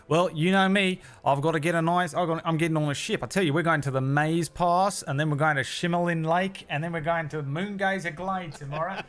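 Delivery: high, loud, quick cockney goodbye; chirpy cockney rogue bowing out of the game; high cockney halfling. Acted. Halfling cheerily quits the card game, high cockney. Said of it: "and he says".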